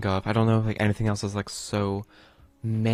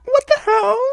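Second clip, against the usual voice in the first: very high, loud, stretched out slowly; stunned shriek; 9 dB louder, slower than usual. Real, not acted. Streamer shrieks seeing the Reaper outside, high and slow. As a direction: shriek it in disbelief. Continued shock.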